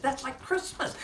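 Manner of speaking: put-on high, loud delighted child; bright discovery; child's delight. Boy hearing good news, high and loud with delight.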